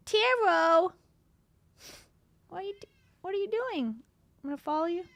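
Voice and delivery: high, sing-song pet voice; talking to the cat. Real, not acted. Streamer cooing at her cat on camera, high and playful. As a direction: coo at the cat as she wanders.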